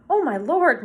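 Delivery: high, loud exclamation of dismay; shocked cry. A narrator's reading. Old woman seeing the dead cat, shocked cry. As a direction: cry out at a dreadful sight. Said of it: "Missus Griffin cried".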